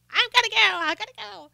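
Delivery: high-pitched mocking imitation; hammed-up high voice, trailing away; flustered getaway. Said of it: "of course, she stammered away".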